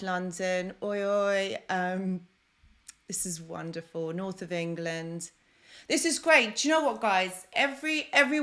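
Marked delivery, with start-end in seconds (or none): 5.9-8.4 much higher and louder, bursting with joy; thrilled cry to the chat; excited burst. Real, not acted. Excited greeting of viewers.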